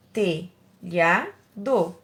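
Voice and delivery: "Telhado" in three separate beats, te, lha, do; syllable by syllable. Shown as a demonstration. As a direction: break "Telhado" at each syllable. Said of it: "três sílabas". ("Telhado" separado em três sílabas)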